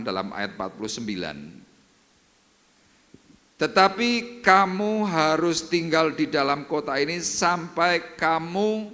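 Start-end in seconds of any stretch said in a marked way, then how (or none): 3.6-8.9 slow, louder preaching of "Tetapi kamu harus tinggal"; scripture proclamation. Acted. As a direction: proclaim the verse with weight, slowly. Reads the scripture verse.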